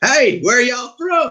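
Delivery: high, slow Southern drawl; mock Mississippi twang, drawn out; imitating a loud Southern guy; Southern accent. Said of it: "they're loud and boisterous".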